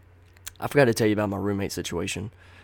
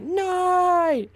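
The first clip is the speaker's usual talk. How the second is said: shrill cartoon scream, much higher and louder; SpongeBob yell; 6 dB louder than usual. Beside Angel's ear on the phone, a cartoon shriek. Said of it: "he yelled it right there".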